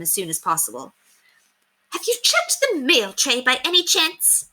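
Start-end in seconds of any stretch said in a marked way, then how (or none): 1.9-4.5 high, imperious society lady; pointed, high-pitched mistress; haughty lady. Acted. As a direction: look down your nose at the maid. Lady Constance asks about mail.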